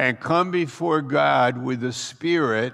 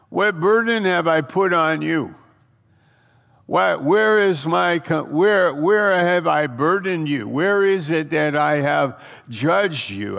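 louder, higher, God's pleading voice; preaching at full force; 3 semitones higher, 4 dB louder than usual. Acted. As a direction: thunder it out as God confronting his people. Preacher voicing God at full force.

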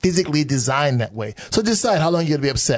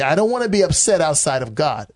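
usual speaking voice

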